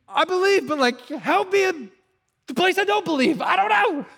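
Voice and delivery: high, loud, strained plea of a struggling father; desperate father pleading. Retold or imitated. Father begs Jesus for help with his doubt, high strained plea. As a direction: beg for help, voice pushed up high. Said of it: "What's the guy say?".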